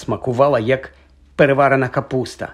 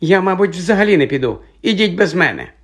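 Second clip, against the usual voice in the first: put-on high, hesitant girl's reply; piercing voice; 6 semitones higher than usual. A narrator's reading. Hermione refuses from behind the cabin door, piercingly. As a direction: pitch it high and piercing, faltering at first. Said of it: "пронизливий голос".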